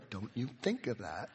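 put-on low, soft warning to the kids; mock-stern parent, voice dropped right down; quiet parental warning. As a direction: warn them off under your breath. Parent warning kids with water balloons.

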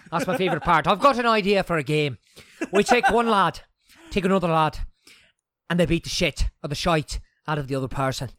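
a hammed-up Irish lad pitching a game; Irish accent. Teasing a friend about his Irish side, in a mock Irish accent. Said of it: "Break out the Irish".

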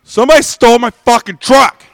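loud, high insistence; insistent shout. Retold or imitated. Fan insisting to his disbelieving friend, shouting. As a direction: yell it so he finally believes you. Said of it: "I said".

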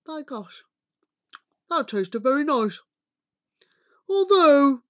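muffled giant muttering to himself. A narrator's reading. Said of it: "He heard the giant mutter twice".

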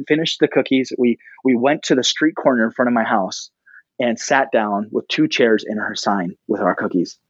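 normal talking voice

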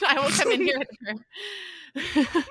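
playful threatening tone; mock threat. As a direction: threaten it teasingly, slow. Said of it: "like, that's a threat".

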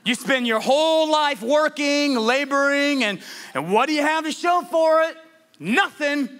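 high-pitched exasperated rant; frustrated Solomon. Acted. Preacher voicing Solomon having one of those days, frustrated. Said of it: "Can you hear the frustration in Solomon's voice?".

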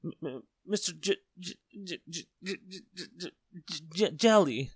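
high, stuttering, finally bursting out the name; frightened stammer. A narrator's reading. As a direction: get badly stuck on the first sound in fear. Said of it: "stammered Mister Jelly".

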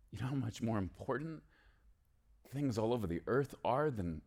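ordinary delivery

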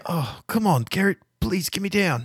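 high, whiny tied-up prisoner; begging captive. Acted. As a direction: beg to be let down. Gazebo begging.

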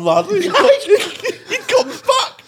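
ordinary talk, nothing marked

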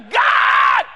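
loud, drawn-out wail to heaven; mock despairing cry. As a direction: bellow it in hammed-up agony. Climax of mock despairing lament.

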